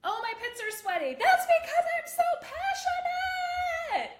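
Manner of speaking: high, loud, over-the-top passionate cry; retelling the host's excited sweaty-pits cry; imitating an excited host. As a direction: gush it out in a big excited voice. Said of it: "and she was like".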